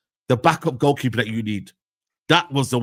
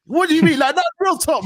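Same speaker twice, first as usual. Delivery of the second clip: exasperated outburst; 13 semitones higher, 4 dB louder than usual. Real, not acted. Ranting about Van Dijk.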